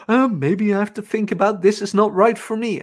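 high-pitched anxious imitation; mock doubting trainee. Imitating coworker doubting the job, high and fretful. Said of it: "She started saying, like".

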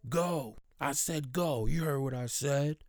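high, sharp mock yell of a rude customer; retold scream. Woman in the post office yelling at a customer, mock scream. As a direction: snap the order out in a half-hearted scream. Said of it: "She just screams".

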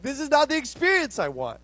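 high-pitched mock outrage; whine-free, high protest of an offended customer; indignant complaint. Acting out his flesh coming out.

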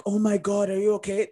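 high panicked "are you okay"; alarmed parent. Retold or imitated. Parent panicking over a fallen child, high alarm. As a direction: overreact to a child's fall. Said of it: "you react like".

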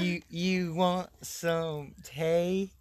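very slow, drawn-out fake British; badly faked British caricature; mock British accent. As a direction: stretch it out in a bad British accent. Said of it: "that was so bad".

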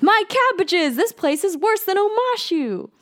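high, anguished mock wail of a ruined merchant; Cabbage Man's wail. Cabbage Man's despairing cry.

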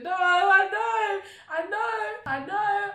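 high, loud, slow anguished cry; distraught wail. Retold or imitated. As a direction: wail it as the news lands. Mum calls crying with news.

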